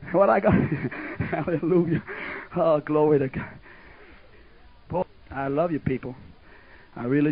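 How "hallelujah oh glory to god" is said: usual speaking voice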